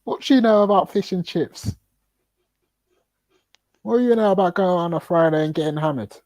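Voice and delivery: high-pitched put-on interrogation; mock challenging local. Testing someone's Britishness as a joke, mock challenging. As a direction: quiz them like a sceptical local.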